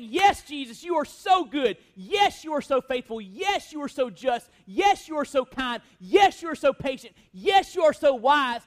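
cheering praise. Pretend. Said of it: "we're gonna be there cheering him on".

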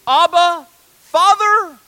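high, loud cry of faith; fervent, ringing call with drawn-out words. Acted. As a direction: shout it up to heaven. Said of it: "what does your cry sound like?".

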